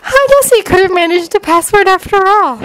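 put-on high-pitched girl's voice; Sakura musing to herself. A narrator's reading. Sakura talks to herself, high-pitched musing. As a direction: muse aloud in a high character voice. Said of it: "Sakura muttered to herself".

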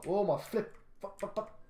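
sudden high, loud burst of swearing; frustrated swearing. Real, not acted. Gamer cursing loudly after crashing his truck in game. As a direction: blurt the curses out in shock.

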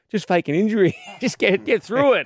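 high-pitched imitation of mum, laughing through it; mock mum voice. Mum's excited reply retold.

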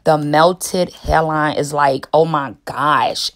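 ordinary talk, nothing marked

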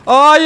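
high, loud yell of "no"; explosive, high-pitched shout; frustrated scream. Real, not acted. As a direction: scream out in gamer rage. Falls in the game, yells.

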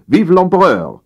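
high-pitched salute to the emperor; called-out cheer. A narrator's reading. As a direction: cry it out like loyal generals. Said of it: "riefen die Marschälle und Generäle".

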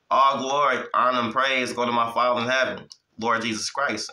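normal talking voice

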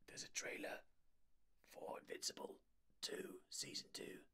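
soft, unvoiced whisper; hushed whisper. Real, not acted. Streamer after startling co-host, whispering the news. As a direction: whisper it so nobody jumps again.

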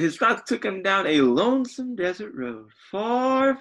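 usual speaking voice

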